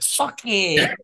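high, loud triumphant taunt; spring the punchline on him; gleeful taunt. Retold or imitated. Prankster finally tricking Chris, high and gleeful.

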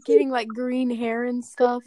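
ordinary talk

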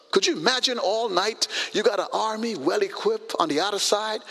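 ordinary talk

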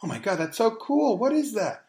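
lightly voiced young woman gushing over a hologram card; mock impressed young woman. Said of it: "these young women go".

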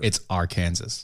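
low "Arkansas" said as ar-KAN-zis; Kansas inside Arkansas. Shown as a demonstration. Host insisting on his pronunciation, "Arkansas" as Ar-Kansas. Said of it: "because Kansas is in the name".

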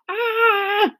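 put-on very high-pitched boy's yell; yell of pain. A narrator's reading. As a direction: yelp as if yanked by the hair. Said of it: "Rupert yelled".

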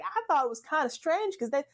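put-on high-pitched older Southern woman's drawl; lively impression of a thick Southern accent. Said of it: "speak in the most thick Southern drawl".

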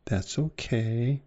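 a soft sigh of giving in; resigned acceptance. Retold or imitated. To a partner who mistreats you, soft slow resignation. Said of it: "and you go".

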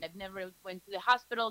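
usual speaking voice

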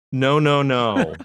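put-on high, loud little-kid refusal; toddler's firm no. A toddler turns away from kissing preteens with a high, loud no. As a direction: play the toddler saying no to the teens.

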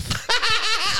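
real high-pitched cackle; piercing evil cackle; evil laugh. Real, not acted. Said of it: "That laugh, it's evil".

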